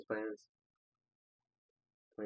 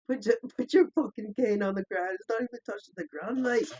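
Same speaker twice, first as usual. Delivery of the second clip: a hint of a British accent, higher; half-hearted British voice, pitched up; British accent attempt; 10 semitones higher, 6 dB louder than usual. Friend attempting his British accent from the video. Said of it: "your fucking amazing British accent".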